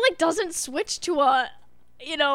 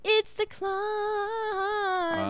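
"It's the climb" sung softly and slowly; too gentle; 6 semitones higher, 7 dB quieter, much slower than usual. Shown as a demonstration. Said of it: "That's a little too gentle".

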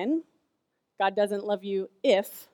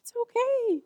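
soothing mom; 13 semitones higher than usual. Retold or imitated. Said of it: "I said".

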